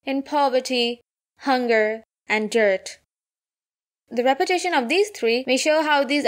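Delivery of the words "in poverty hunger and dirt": "poverty", "hunger", "and dirt" set apart by pauses; pause after every word. Acted. Seamstress lists her hardships, pausing after each one. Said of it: "she pauses after every word".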